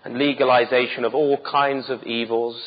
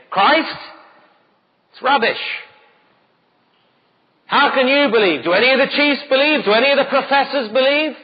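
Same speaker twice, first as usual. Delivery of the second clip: sneering elite voice, much higher and louder; scornful elite; 10 semitones higher, 4 dB louder than usual. Retold or imitated. Preacher mocks the cultured despisers of Christianity with a sneer. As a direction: scoff like a haughty professor. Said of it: "the intellectual elite who say".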